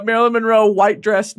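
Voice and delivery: normal talking voice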